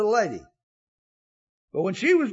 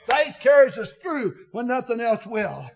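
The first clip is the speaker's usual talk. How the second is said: ordinary delivery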